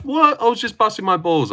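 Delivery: high, whiny mock innocence; mocking whiny chatter. Mimicking the chatter's fake innocence, high and whiny. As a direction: play dumb like the caught troll.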